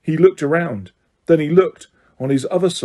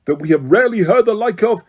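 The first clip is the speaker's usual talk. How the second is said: higher, louder stern voice; speaking more loudly; 5 semitones higher, 3 dB louder than usual. A narrator's reading. Crouch over his son's voice, louder stern voice. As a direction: drown out the pleading son. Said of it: "speaking more loudly".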